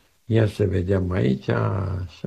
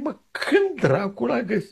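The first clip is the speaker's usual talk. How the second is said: baffled inner exclamation; 13 semitones higher than usual. Retold or imitated.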